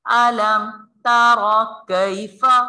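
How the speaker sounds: slow chanted verse recitation; Quran recitation. Shown as a demonstration. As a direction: recite it slowly and melodically. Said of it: "बगैर खींचे".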